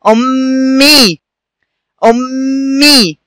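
"أمي" slowly, with a long hummed m and drawn-out ee; doubled m demonstration. Shown as a demonstration. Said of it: "معايا يا أصدقائي تاني".

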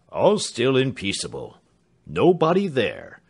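loud, confident report; Mr Britain, hearty manservant. Acted. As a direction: announce the all-clear loudly, slowing at the end.

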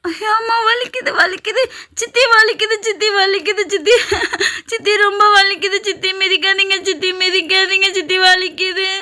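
put-on high, wailing girl's pleading; crying in pain. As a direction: beg her to stop through sobs, a laugh slipping in. Girl being kicked, crying in pain.

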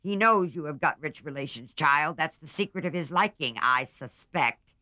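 put-on a stern old aunt needling her niece; sharp old aunt. A narrator's reading. Aunt March voiced in story.

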